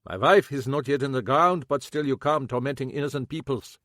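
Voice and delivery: lightly voiced old German man, bitter; old German shoemaker, a hint of German accent; German-accented cobbler. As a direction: grumble it with a German accent, grieving.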